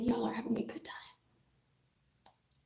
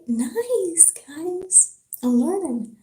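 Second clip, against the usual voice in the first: bright pitch jump, pleased with herself; proud little learner's cheer; excited; 4 semitones higher, 4 dB louder, slower than usual. Real, not acted. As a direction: cheer yourself on, a little higher. Pleased with getting French right.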